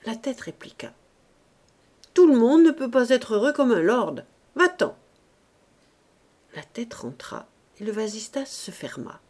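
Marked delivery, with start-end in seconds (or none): 2.1-5.0 loud, high, scornful rebuff; irritated shout ending in a sharp order; gruff dismissal. Acted. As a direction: send the child packing. Man scornfully sends child away.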